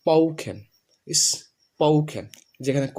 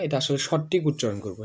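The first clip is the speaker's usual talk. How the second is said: ordinary delivery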